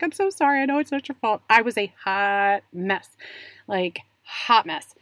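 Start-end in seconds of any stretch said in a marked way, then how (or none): 0.0-1.4 higher, pleading apology; a lightly voiced, sorry plea; apologetic. Mother apologizes to the insurance lady, pleading. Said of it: "then I was apologetic".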